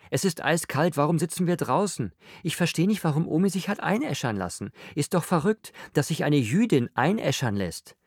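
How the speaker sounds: high, fretful rant; agitated griping. A narrator's reading. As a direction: complain on and on, pitched high. Josephine's fretful rant at family.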